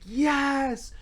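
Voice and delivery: high, drawn-out mock scream; imitating your own ecstatic scream; retold scream. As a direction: let a long, high "yes" ring out, held back in volume. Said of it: "screamed at the top of my lungs".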